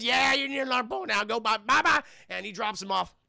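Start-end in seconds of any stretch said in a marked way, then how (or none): 0.0-2.0 loud, higher mock holler; brusque send-off. Driver hurrying the reindeer hopeful away, mock hollering. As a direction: shoo them off noisily.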